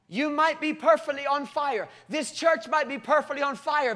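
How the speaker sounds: ordinary talk, nothing marked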